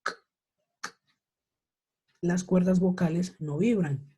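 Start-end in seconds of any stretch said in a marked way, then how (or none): 0.0-1.0 "K, K, K" as separate voiceless bursts; isolated "K" repeated without voice; bare K sound. Shown as a demonstration. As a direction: say each "K" as just "k", no vowel. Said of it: "Cuando yo hago ese sonido".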